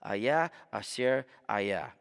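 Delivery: "Ayah Asher Ayah" said slowly in Hebrew, each word spoken clearly and apart; Hebrew pronunciation. Shown as a demonstration. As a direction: pronounce "Ayah Asher Ayah" carefully, as a teacher showing the Hebrew. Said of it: "sounds like this".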